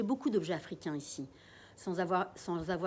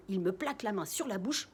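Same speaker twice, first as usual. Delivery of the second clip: usual speaking voice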